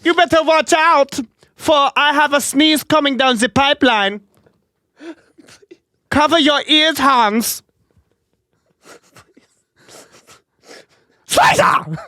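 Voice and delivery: hammed-up German sneezing man, very high; a thick mock German accent in a squeaky voice; silly cartoon German accent. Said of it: "that fucking German accent".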